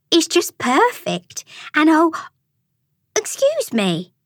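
high, slow gushing broken by surprise; gushing, then interrupted. A narrator's reading. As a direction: gush high, then catch yourself. Frida gushes, then is interrupted.

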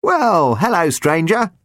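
high, beaming cheerful greeting; beaming chirp. Acted. Jumper greets reviving Albard, high and beaming. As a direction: beam at him as he wakes. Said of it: "beamed Jumper".

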